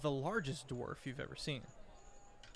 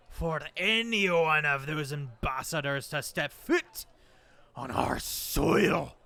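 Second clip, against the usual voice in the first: booming accusation; 9 dB louder than usual. Acted. Dwarf lord scorning the islanders' church, loud and angry. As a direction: spit scorn at their fake church.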